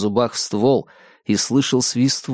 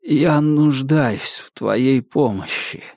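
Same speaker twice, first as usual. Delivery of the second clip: ordinary delivery, nothing marked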